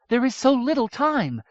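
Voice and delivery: lightly voiced anxious young woman; urgent princess. A narrator's reading. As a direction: hurry him along. Miriamel voiced high.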